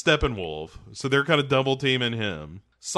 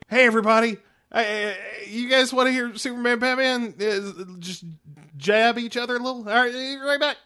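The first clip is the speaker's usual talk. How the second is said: high, fast hype-man voice; hype guy; 9 semitones higher than usual. Acted. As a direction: work the crowd like an emcee. Announcer-style bro voiced quote.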